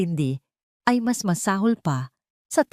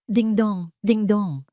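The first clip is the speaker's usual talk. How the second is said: a higher, two-note falling ding-dong; doorbell imitation; 4 semitones higher, slower than usual. A narrator's reading. Narrator sounds the doorbell that interrupts the attack, chiming. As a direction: chime it like a doorbell, twice.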